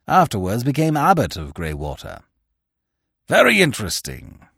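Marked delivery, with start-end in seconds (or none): 3.3-4.5 higher, louder exclamation; loudly. A narrator's reading. Sir Lionel reacting to the monk's plan, loud and eager. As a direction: call it out with gusto. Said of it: "cried Sir Lionel loudly".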